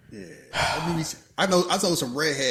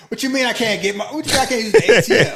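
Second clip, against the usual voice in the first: high, loud panicked outrage; outraged yell; 10 semitones higher, 13 dB louder, faster than usual. Retold or imitated. Citizen shouting that the ATM won't work, loud outrage. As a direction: shout it like a broke citizen who just lost their cash.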